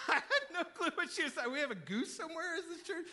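high, loud, laughing disbelief; laughing bafflement. Real, not acted. Pastor recalling his confusion over the goose, laughing in bafflement. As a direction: say it through an incredulous laugh.

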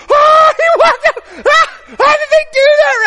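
high, loud mock shriek of astonishment; kids' amazed screaming. Preacher acts out kids at camp seeing the trick, amazed screaming. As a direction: imitate a crowd of kids shrieking in wonder. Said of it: "all the kids were like".

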